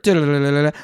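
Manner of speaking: loud sung drum roll standing in for the sound effect; cheeky vocal drum roll filling in for the missing effect. Pretend. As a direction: rattle off a mock drum roll. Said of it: "You're gonna have to go for it".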